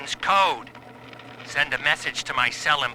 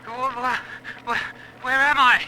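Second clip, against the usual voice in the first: panicked stammer; 8 semitones higher, slower than usual. Acted. Prisoner waking in interrogation chair.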